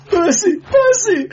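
high, loud wail; moaning plea. A narrator's reading. Beaten clerk to his attacker, moaning wail. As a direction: wail for mercy as if being kicked. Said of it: "moaned the victim".

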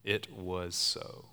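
"It was so" said slowly, each word weighted; slow emphatic "It was so". Shown as a demonstration. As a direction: stretch out "It was so" deliberately. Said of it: "You see it?".

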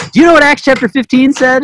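half-mocking rant at the older sister; mock yelling. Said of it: "yelling at this poor older sister".

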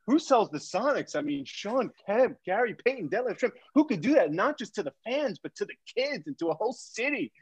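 put-on high-pitched, sing-song parody of an indignant kid; mocking mimicry. CEO mimicking the guest's rant to his son, mocking.

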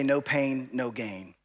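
joking, low-pitched lifter's motto; mock bodybuilder. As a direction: put on a deep gym-guy voice. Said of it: "When I was a bodybuilder, we would say".

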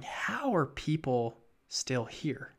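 slow, puzzled whisper. Retold or imitated. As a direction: breathe it out in disbelief. Retelling puzzled thought at work.

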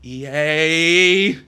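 drawn-out, rising, loud mock rage; hammed-up hateful outburst; mock anger. Mock outburst at game company.

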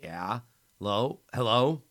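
jolted awake, calling into the phone; grating sleepy voice. A narrator's reading. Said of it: "her grating voice eked out".

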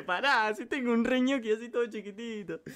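loud, high mock pleading; high-pitched plea. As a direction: cry it out in a high, squealing comic plea. Acted plea he wanted to say.

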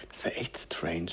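breathy whispered aside; whispering. Real, not acted. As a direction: whisper it to yourself. (geflüstert)

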